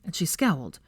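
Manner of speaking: ordinary delivery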